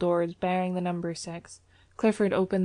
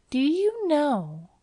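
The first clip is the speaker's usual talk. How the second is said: high, slow, drawn-out lead-in; calm; 8 semitones higher, 4 dB louder, much slower than usual. A narrator's reading. Valentine to Clifford, calmly opening a rebuke. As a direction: stretch it out coolly with pitch lifted. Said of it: "she said calmly".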